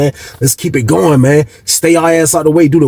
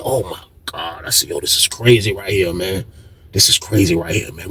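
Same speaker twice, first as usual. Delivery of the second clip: low, much softer disbelieving murmur; stunned hushed mutter; 8 semitones lower, 7 dB quieter than usual. Retold or imitated. Narrator reacting to the scene in his cell: hushed stunned mutter. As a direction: mutter it under your breath in shock.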